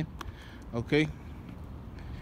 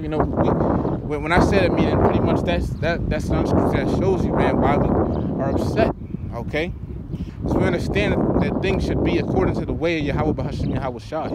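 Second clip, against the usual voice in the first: louder, driving preacher's voice; preaching hard; 6 dB louder than usual. Acted. Preacher telling brothers why they're upset, loud and driving. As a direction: hammer the point home to the brothers.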